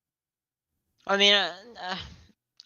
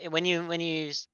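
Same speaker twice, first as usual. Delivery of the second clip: usual speaking voice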